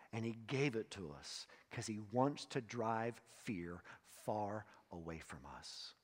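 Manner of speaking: normal talking voice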